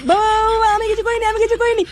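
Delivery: put-on pleading child. Boy caught smoking by father.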